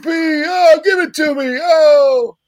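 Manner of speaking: high, loud, overexcited announcer impression; Dick Vitale. Radio host cheering Ricky P. as a famous announcer, loud hammed-up impression. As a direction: draw out the big yells like a raving sportscaster. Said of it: "was that supposed to be Dick Vitale?".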